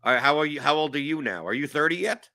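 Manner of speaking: ordinary talk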